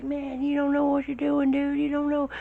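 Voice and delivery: very high yelled warning; high strained shout mid-air; shouting over wind. Retold or imitated. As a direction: yell at him that he's messed up. Instructor yelling over wind.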